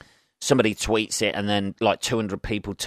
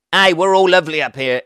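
loud, higher put-on cheery local; chummy Glaswegian; 9 semitones higher, 8 dB louder than usual. Voicing Glasgow locals to listeners, mock-friendly and loud. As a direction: hail them as a friendly local.

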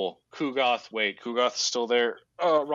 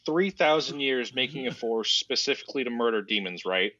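normal talking voice

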